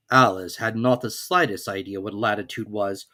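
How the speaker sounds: ordinary delivery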